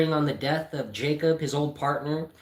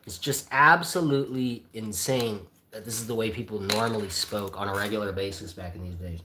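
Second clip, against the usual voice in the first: plain reading voice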